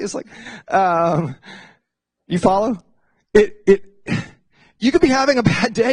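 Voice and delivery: ordinary talk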